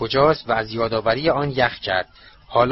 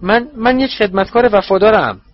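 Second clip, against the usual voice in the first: higher, louder stammer of a cringing servant; 8 semitones higher, 6 dB louder than usual. Acted. Wormtail pleading loyalty to his master, higher and stammering. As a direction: insist you are loyal, stumbling. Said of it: "ورمتیل گفت".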